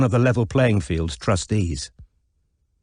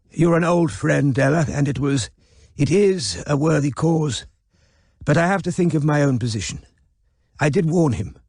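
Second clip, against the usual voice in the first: put-on frail old gentleman justifying himself; elderly Sir Kevin; 6 semitones higher than usual. Old man Sir Kevin speaking.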